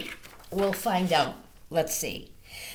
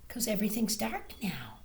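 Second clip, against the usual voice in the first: hushed whisper; 7 dB quieter, faster than usual. Real, not acted. Reading to children, a hushed whisper. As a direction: whisper because it's night.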